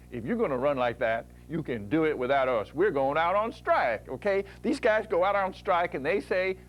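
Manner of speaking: lightly voiced tough union workers on strike; hint of a defiant striker; mock striking union boys. Fat-burning enzymes threaten their boss with a strike, lightly tough. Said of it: "we always make a joke and we say".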